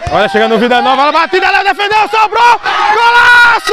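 racing build to a high golaço shout; explosive goal commentary. Real, not acted. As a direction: speed up, then explode high.

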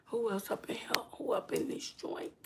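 unvoiced playful whisper. Real, not acted. Whispering a hello to viewers who joined. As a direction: whisper it like a secret.

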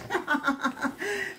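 high, airy giggle; delighted laugh. Real, not acted. Vlogger laughs with joy at her viewers over the gear. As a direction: let out a gleeful laugh.

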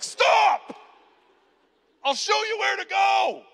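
very high, loud drawn-out cry; hammed-up heroic yell; alarmed shout. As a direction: holler to halt an intruder. Knight jumps up to halt intruder.

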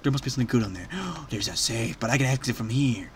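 ordinary talk

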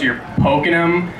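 ordinary delivery

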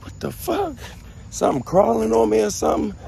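alarmed high-pitched what the fuck; startled freak-out. Retold or imitated. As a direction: squeal like something's crawling on you. Panicking about itching in story.

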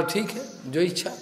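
very soft, submissive reply; bow to it in a hushed voice; humble, barely voiced assent; meek acceptance. Vidur bows and meekly accepts.

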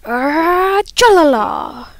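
loud, high, silly scream. Real, not acted. Gamer yelling nonsense at the game, screaming. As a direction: wind up, then belt out the nonsense word. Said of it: "I don't know why I'm screaming at chalala".

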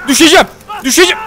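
high, urgent yelp; quick high-pitched alarm; alarmed cry. Real, not acted. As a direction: cry out as you start to fall. About to fall in game.

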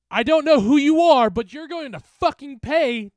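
high full-force yell; furious shouted threat. Acted. Bully threatening the specter that holds him, shouting. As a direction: roar the threat at it. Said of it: "Derek shouted at it".

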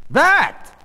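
loud, high jab of a word; sharp exclamation. Acted. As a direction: point it out with a startled cry. Wife pointing at rash, startled.

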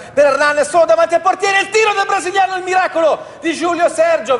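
rising excited play-by-play shout; breathless italian goal commentary. Acted. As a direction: call the big save at full pitch. Live goal chance commentary.

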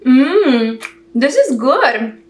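chewing while talking; muffled by food in mouth; mouth full. Real, not acted. Seen 4: bite taken at -2.0, lips closed and chewing during words.

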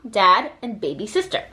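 plain reading voice

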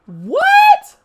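squeaky high disbelieving what; stunned outburst; shocked what. Real, not acted. Friend reacts in disbelief, shocked high squeal.

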